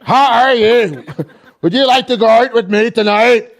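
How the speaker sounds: hammed-up girlfriend's mixed accent, laughing; French-Northern Irish accent. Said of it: "she'll be like".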